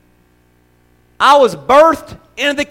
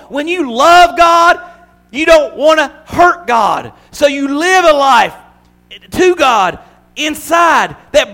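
louder, slower, soaring preacher voice; preaching at full force; 6 semitones higher, 5 dB louder than usual. Acted. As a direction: build it like a revival climax.

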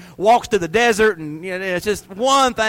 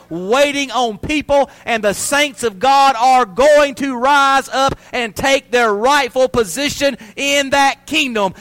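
rising preacher's cry, slow and louder; preaching; 6 semitones higher, 5 dB louder, slower than usual. Acted. Pastor to congregation, building into full-force preaching. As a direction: build it to a shout on death swallowed up.

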